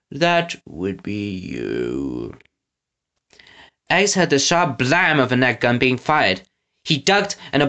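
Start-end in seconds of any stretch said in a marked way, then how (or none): 0.1-2.4 low, soft, very slow threat; menacing villain. Acted. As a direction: drop low and draw out the threat. Villain threatening his captive.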